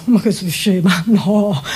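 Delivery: usual speaking voice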